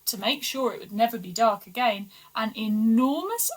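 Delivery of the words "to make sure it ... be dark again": plain reading voice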